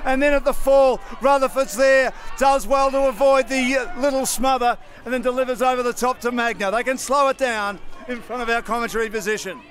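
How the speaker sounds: brisk football commentator calling the play; live play-by-play. Acted. Caller describing the match to radio listeners, brisk play-by-play. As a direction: call each move as it happens.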